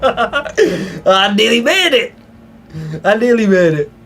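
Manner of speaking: high, laughing cry of defeat; laughing lament. Real, not acted. Streamer caught by the enemy, laughing in dismay. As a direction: laugh it out after getting caught. Caught after chase in game.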